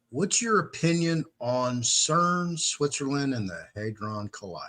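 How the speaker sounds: normal talking voice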